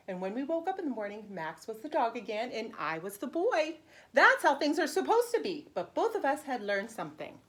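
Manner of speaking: bright, lively read-aloud for preschoolers; animated picture-book narration; storybook reading. Acted. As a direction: read it like story time for little kids. Teacher reads picture book aloud.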